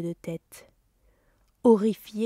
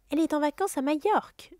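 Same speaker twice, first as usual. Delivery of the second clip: clipped, higher dismissive retort; curt reply; 8 semitones higher, faster than usual. Acted. Aunt Petunia shutting down the idea, curt and dry. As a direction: cut the question off dryly. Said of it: "répliqua sèchement".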